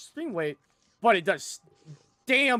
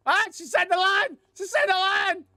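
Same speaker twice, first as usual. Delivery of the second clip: thrilled shout; 14 semitones higher, 5 dB louder than usual. Real, not acted. Game character says the quoted line.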